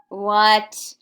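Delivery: loud mock "what?"; a hammed-up gasp of surprise. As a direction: feign shock at the reveal. Mock shock at own reveal.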